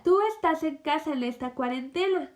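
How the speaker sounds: usual speaking voice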